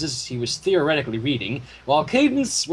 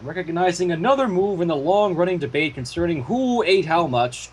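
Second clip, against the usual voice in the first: ordinary delivery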